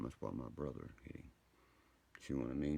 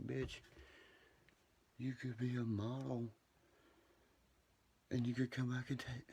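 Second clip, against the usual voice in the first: much higher, eager pitch; excited pitch-up; 9 semitones higher than usual. Retold or imitated. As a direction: sell her the dream, pitched way up. Flirting with Swedish woman.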